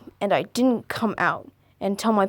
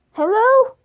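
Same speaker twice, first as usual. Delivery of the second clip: very high, louder panicky hello; squeaky supervisor; 7 dB louder, slower than usual. Shown as a demonstration. Confused supervisor on the dead line, high and squeaky. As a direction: jump way up into a squeaky scared voice. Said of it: "How does the supervisor sound?".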